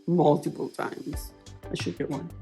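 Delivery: normal talking voice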